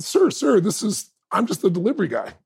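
delivery man at the door pleading with the proud father. Retold or imitated. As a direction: plead gently with the angry dad. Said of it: "the man said".

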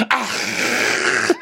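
high breathy fake gasp; mock gasp. Podcaster imitating T J's shocked reaction, high mock gasp. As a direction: gasp like a scandalized snob. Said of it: "T J giving this".

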